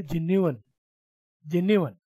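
जेन्युइन as jen-yu-in, carefully; correct pronunciation. Shown as a demonstration. Teacher to class, careful model of जेन्युइन. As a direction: spell out जेन्युइन syllable by syllable. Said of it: "तो सही वाला बता देता हूँ".

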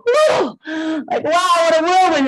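high, loud mock astonishment; hammed-up gasp of a traveller home at last; overwhelmed whoa. Back from travelling to parents, mock overwhelmed exclamation.

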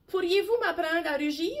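a tiny mouse daring to speak up; timid little mouse. Acted.

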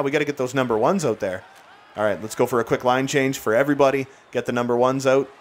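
ordinary talk, nothing marked

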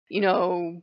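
ordinary talk

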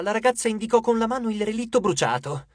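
ordinary delivery

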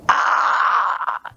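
long, breathy mock scream; retold scream. A father screams back in the house, mock scream. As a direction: imitate a dad's drawn-out scream. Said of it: "he screams really, really loud".

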